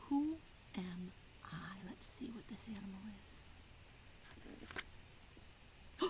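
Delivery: whispered, slowing down for suspense; hushed riddle whisper. Acted. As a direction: whisper it like a secret to a child. Reading a guessing riddle to children.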